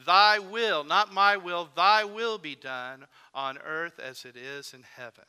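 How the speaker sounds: "Thy" loud and leaned on, then "not my" in contrast; preacher stressing "thy" over "my". Shown as a demonstration. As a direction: punch "thy", set "my" apart. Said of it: "to emphasize the words, thy".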